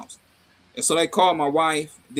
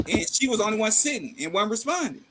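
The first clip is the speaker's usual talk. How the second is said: ordinary delivery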